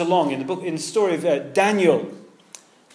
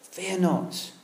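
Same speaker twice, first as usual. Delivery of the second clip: gentle reassurance; 7 dB quieter, much slower than usual. Retold or imitated. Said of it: "look what he says".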